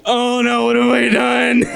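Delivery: high, hammed-up horror through a laugh; a kid's overplayed gasp of guilt, laughing; laughing, high mock panic.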